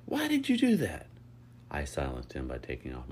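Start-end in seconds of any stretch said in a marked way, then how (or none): 0.1-1.1 very high, loud protest; indignant yell. A narrator's reading. Lucas surfacing in the pool, yelling in protest. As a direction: yell it, pitched way up. Protesting after being thrown in water.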